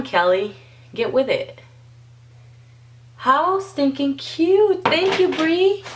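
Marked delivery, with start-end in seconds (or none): 3.2-5.8 gushing thanks. Real, not acted. Thrilled thanks to her friend.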